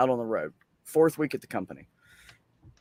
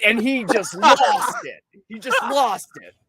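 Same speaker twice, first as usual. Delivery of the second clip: laughing; 8 semitones higher, 4 dB louder, slower than usual. Real, not acted. Laughing while telling punchline.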